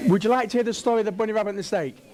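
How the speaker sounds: very fast, words run together; rapid-fire repeat. Real, not acted. Speaker re-asking the prompted audience, rapid-fire. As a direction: rattle the question off in one breathless rush.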